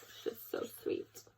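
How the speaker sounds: soft whisper of delight; whispered aside. Real, not acted. Reader whispers to viewers how sweet the story is. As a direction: breathe it to yourself. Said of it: "reader's own whisper aside".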